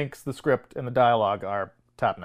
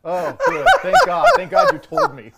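sarcastic thanks through high-pitched laughter; squealing with laughter, mock-grateful; laughing mock relief; 5 dB louder than usual. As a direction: crack up while feigning huge relief. Sarcastic relief while cracking up.

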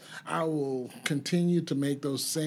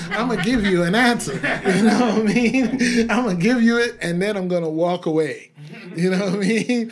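laughing loudly through the words, pitched up; cracking himself up as he talks; 6 semitones higher, 9 dB louder than usual. Real, not acted. Laughing at his old drinking self, loud and high.

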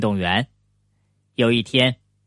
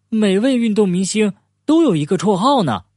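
lightly voiced character, higher pitch; a higher, lightly acted storybook line; turtle character remarking; 6 semitones higher than usual. A narrator's reading. Franklin remarks on sports stars' nicknames, lightly voiced and higher.

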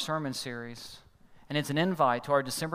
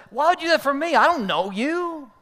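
much higher, louder, slowing at the end; baffled anger; 11 semitones higher, 9 dB louder than usual. Retold or imitated. Driver confronting the stranger who paid, loud and baffled. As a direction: push back, suspicious. Angry man confronting Dan.

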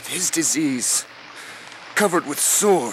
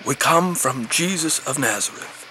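ordinary delivery

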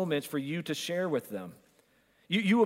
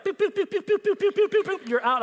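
very high, loud pew pew pew like a toy ray gun; rapid-fire cartoon laser pews, high and loud; mock laser zaps; 6 dB louder than usual. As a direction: zap them out with silly high blaster noises. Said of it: "They're like".

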